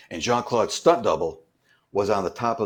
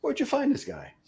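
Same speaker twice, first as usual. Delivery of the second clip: high, put-on voice of the star, amused; Jean-Claude impression. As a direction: lightly voice the star as he jokes about the new double. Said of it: "He was like".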